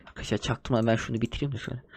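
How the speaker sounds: hushed fast aside to the audience; conspiratorial murmur to viewers; sneaky aside. Real, not acted. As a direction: tell the viewers secretly, quick and low.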